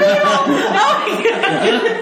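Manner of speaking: very high, slow, laughing oh my god; amused mock gasp. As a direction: stretch it out high while laughing. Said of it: "And they're like".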